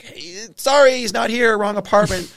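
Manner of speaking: sing-song fake-innocent girlfriend brushing off a cop; girlfriend covering for him. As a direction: play the flustered girlfriend lying at the door. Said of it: "girlfriend's like".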